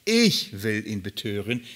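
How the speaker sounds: „Ich“ pushed up and held at the start; stress on ich. Shown as a demonstration. Preacher reading the spirit's offer, stressing „Ich“. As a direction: lean hard on „Ich“ in „Ich will ihn betören“. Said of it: "Die Betonung ist auf ich".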